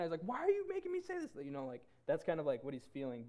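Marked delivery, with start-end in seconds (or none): 0.2-1.3 very high-pitched, mock-panicked squeal; freaking out. Peter asking why he must say this, freaking out. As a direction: squeal it in hammed-up exasperation. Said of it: "freaking out".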